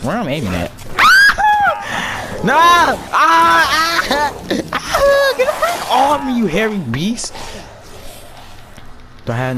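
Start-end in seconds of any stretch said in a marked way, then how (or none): 0.8-2.4 high, shouted hysterical laugh; shrieking laugh. Real, not acted. 2.4-7.3 high, loud screams of panic; panicked screaming. Real, not acted.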